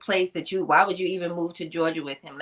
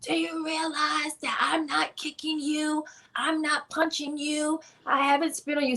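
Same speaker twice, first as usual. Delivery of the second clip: strained choked plea; 10 semitones higher, 3 dB quieter than usual. Retold or imitated. Pleading with her abusive husband while being choked, high strained voice. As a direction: squeeze the words out like a throat being held.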